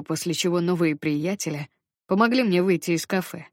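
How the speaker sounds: ordinary delivery, nothing marked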